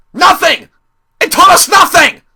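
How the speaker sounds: high, very loud exasperated yell; outraged shout. Real, not acted. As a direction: explode in indignation. Answers own question in outrage.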